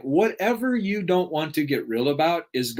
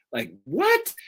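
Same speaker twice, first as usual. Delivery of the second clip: incredulous what; 7 semitones higher than usual. Real, not acted. Disbelief at mom's suggestion.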